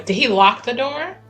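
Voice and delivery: normal talking voice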